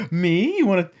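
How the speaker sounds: high, loud coy voice; mock flattered hot chick. Playing the pursued hot chick turning a guy down, coy and high. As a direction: play hard to get, hammed up.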